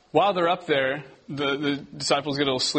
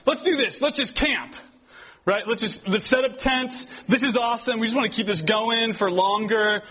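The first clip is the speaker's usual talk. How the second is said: high, laughing excited mock disciples; 6 semitones higher than usual. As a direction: gush like thrilled campers wanting to stay. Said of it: "They go, they go".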